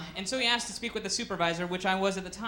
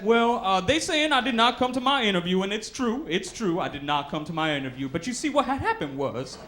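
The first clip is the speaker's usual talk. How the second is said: frantic excuse; 3 semitones higher, 4 dB louder than usual. Retold or imitated. Caller frantically claiming his kids were kidnapped, hammed-up. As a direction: pile on the lie, faster and faster.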